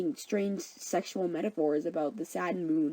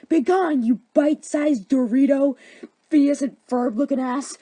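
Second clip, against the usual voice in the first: loud, high, hammed-up banishment; scornful shout; 5 semitones higher, 7 dB louder than usual. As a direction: hurl the insult with mock grandeur. Lysander insults and banishes Hermia.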